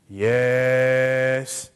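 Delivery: one long, held, monotone YES; rude, drawn-out yes. Retold or imitated. Jeremiah says the right thing wrongly, dragging it out rudely. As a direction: stretch it out far too long, rudely. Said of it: "but he says it wrongly".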